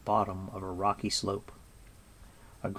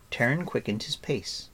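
plain reading voice

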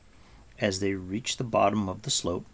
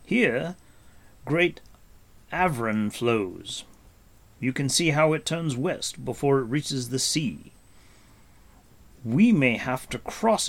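raised, deliberate voice of a guide; Gwydion; 4 semitones higher, 3 dB louder than usual. Acted. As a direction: lay out the land with weighty emphasis. Gwydion explains the map.

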